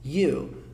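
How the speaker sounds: "You" said as a long yu; English you. Shown as a demonstration. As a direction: stretch "You" into yu. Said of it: "olha a pronúncia".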